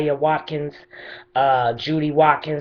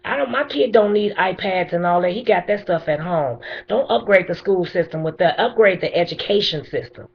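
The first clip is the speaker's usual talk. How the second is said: pleading furiously with the mayor; impassioned angry plea; 6 semitones higher than usual. Real, not acted. Grieving mother confronts the mayor.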